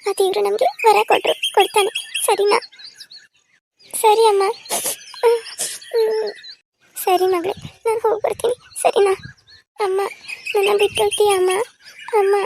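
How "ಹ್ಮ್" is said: a long high crying whimper; tearful whimper. Acted. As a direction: let a small sob slip out. Daughter weeps as mother leaves. (ಅಳುವಿನ ನರಳಾಟ)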